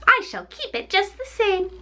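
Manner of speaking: high, gloating witch voice; mocking witch. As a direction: sneer it like a crone who has won. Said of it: "laughing at her".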